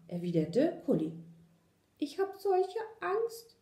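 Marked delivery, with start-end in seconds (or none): 2.0-3.6 high, slow little mouse voice; frightened whispery squeak. A narrator's reading. Little mouse confessing fear to the dog, frightened squeak. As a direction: let it out small and scared, very high. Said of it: "wisperte das Mäuschen".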